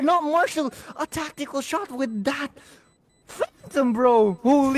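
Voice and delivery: high, amazed play call; excited. Real, not acted. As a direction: rave about the shot. Caster reacts to a clutch shot.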